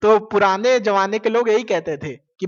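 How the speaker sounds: ordinary talk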